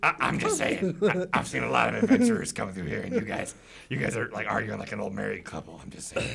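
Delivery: soft, hedging goblin voice; hushed creature sticking to its point; goblin interjecting. Acted. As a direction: offer a quiet opinion as a goblin. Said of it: "One of the goblins interjects and says".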